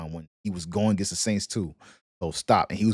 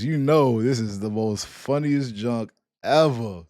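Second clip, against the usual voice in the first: ordinary talk, nothing marked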